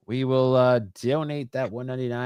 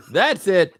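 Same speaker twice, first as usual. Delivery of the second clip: laughing; 7 semitones higher, 5 dB louder than usual. Real, not acted. Laughing after crowd joke.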